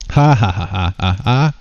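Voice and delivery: acted mocking laugh. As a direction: laugh in separate, measured ha's. Game figure laughs at news.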